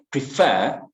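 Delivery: "prefer" with FER drawn out and swooping; stress on second syllable. Shown as a demonstration. Teacher showing second-syllable stress, slow stressed "prefer". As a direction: stretch and punch the end of "prefer". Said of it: "the stress is at the second".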